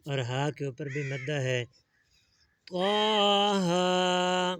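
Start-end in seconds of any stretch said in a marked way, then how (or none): 2.7-4.6 त्वाहा slow, higher, vowels held; slow tajweed demo; drawn-out recitation. Shown as a demonstration. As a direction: chant त्वाहा with a long madd. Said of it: "ता के ऊपर मद्दा है".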